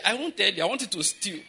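ordinary talk, nothing marked

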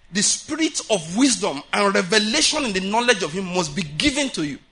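normal talking voice

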